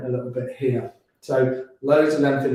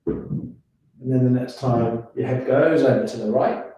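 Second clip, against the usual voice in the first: guided yoga instruction. Acted. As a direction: ease them through each breath. Guides neck stretches slowly.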